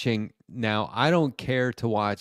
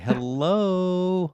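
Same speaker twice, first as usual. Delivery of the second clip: mock hello; 8 semitones higher than usual. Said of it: "it's like".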